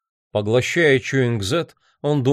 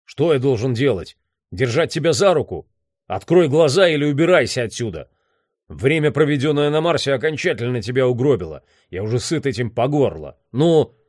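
higher, exasperated scolding; raised, annoyed man's voice; irritated gruff boss; 4 semitones higher than usual. Acted. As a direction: berate him, fed up and impatient.

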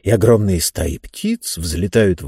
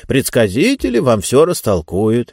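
usual speaking voice

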